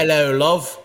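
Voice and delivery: put-on a light British accent greeting. Co-host practicing for his London trip, mild British accent. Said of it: "Practicing his British accent".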